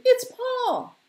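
very high, loud cry of recognition; scream. Acted. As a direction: scream out who it is. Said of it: "she screamed".

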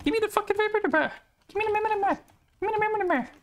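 very high cartoonish demand; squeaky silly voice.

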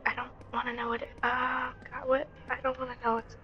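fast, hushed, panicked; scared rushed whisper; frightened whisper. Real, not acted. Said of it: "I saw something".

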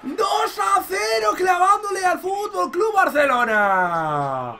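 slow, high, drawn-out goal yell; elated stretched goal call; goal shout. Real, not acted. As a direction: scream the goal like a commentator. Second goal celebration.

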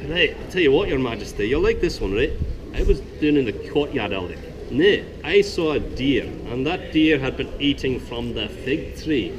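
put-on jester's joke in a soft but decisive Scottish accent. Jester telling the king a joke in a Scottish accent. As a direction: spin the yarn in a lively Scottish lilt, rising to a big finish. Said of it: "I'm gonna do a soft, but hopefully decisive Scottish dialect".

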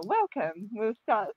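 ordinary delivery

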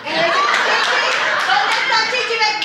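high, drawn-out pet-calling voice; mock coaxing call to a dog. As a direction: tease by calling her like a little dog. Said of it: "Ese es nombre de perra".

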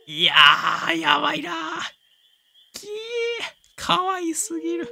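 high squealing cries of delight; squealing over cuteness. Real, not acted. Gamer squealing at his adorable puppy sitting, excited shriek.

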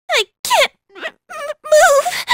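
straining cry. Acted. Character stuck, straining.

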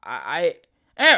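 halting repeated I; stammering. A narrator's reading. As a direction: stutter nervously. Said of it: "stammered Grummer".

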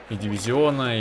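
ordinary delivery

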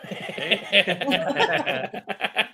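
a real burst of laughter; laughing. Real, not acted. Laughing off the dare to call.

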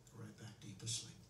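soft, sinking trance suggestion; hypnotic deepening voice. Acted. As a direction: ease them down into sleep.

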